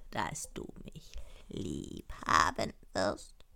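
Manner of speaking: very low, slow, soft frog voice; low drawn-out frog with quacks; demanding frog. Acted. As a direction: spell out demands slowly, punctuate with croaks. Frog figure makes demands.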